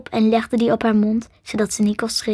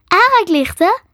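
high, louder questioning echo; puzzled girl; 8 semitones higher, 5 dB louder than usual. A narrator's reading. Girl queries the creature's strange word, high and puzzled.

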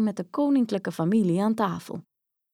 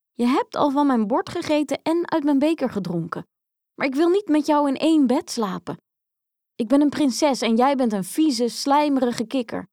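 higher, protesting princess voice; indignant princess; 6 semitones higher, 4 dB louder than usual. Acted. Princess refusing frog a place in bed, indignant princess. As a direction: refuse in high-pitched disgust.

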